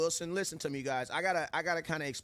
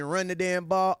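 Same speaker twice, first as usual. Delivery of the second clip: louder mock yell at a phone; imitated yell; 4 semitones higher, 8 dB louder than usual. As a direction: yell it like a fan barking at the TV. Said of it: "this dude that be screaming at his cell phone".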